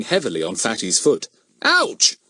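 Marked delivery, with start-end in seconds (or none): 1.6-2.2 high sudden yelp; sharp yelp of pain. Acted. Fatty yelping when Goon treads on his foot, sudden high cry. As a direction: cry out as your foot is trodden on.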